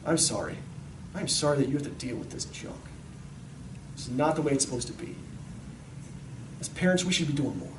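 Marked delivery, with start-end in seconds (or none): 0.1-5.2 very soft, lower, sorrowful voice; tender apology. Acted. Parents telling their kids sorry for this junk, tender. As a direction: console a child quietly and sincerely.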